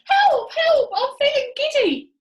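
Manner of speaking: very high, loud scream. Acted. Missus Twit screams for help, giddy and terrified. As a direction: cry for help at the top of her lungs. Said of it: "screamed Missus Twit".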